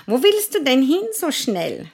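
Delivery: hohe, laute Mäusestimme; Maus. Acted. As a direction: piepsig fragen. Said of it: "sagte die Maus". (Mäusestimme)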